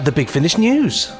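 ordinary talk, nothing marked

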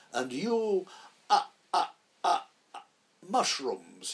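high, halting stammer hunting for the word; forgetting the lines. Retold or imitated. Old actor losing his lines mid-speech, halting, stammering. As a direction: stall on each ah until the wrong word pops out. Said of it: "he suddenly remembered".

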